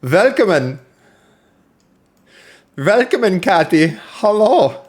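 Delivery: high, loud mock German accent, v for w; terrible German impression. Greeting a chatter in a hammed-up German accent. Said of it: "that was a terrible German impression".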